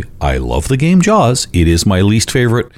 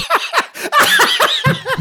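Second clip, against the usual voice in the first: high laugh. Real, not acted. Laughs at own accent joke.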